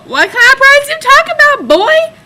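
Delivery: loud, very high-pitched squawk; acted indignant retort. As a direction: blurt it way up high, hammed-up. Acted retort, only thought it.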